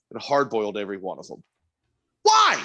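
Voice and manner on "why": high, loud exasperated cry of why; venting disbelief at the kitchen staff; pitched way up in exasperation; exasperated outburst. Real, not acted. Exasperated rant about used eggs.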